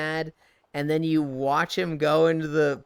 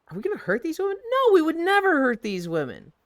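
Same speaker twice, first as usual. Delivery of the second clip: high, insistent mock reassurance; sketch character; 12 semitones higher, 3 dB louder, faster than usual. Sketch character reassures friend, high and insistent. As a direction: overeager denial that fools nobody.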